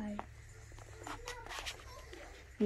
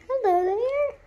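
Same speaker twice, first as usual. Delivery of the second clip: very high, loud hello; squeaky hello; 5 dB louder than usual. Pretend. A child greets the game character in a high squeaky voice. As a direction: greet them in a tiny squeaky voice.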